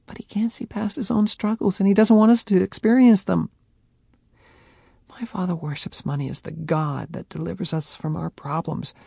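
Faye, tearful and sincere; tender plea. A narrator's reading. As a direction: explain from the heart.

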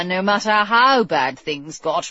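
ordinary delivery

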